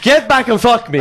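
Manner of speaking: high, loud mock command, half laughing; retold urgent demand. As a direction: act out a loud, desperate order. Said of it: "I said".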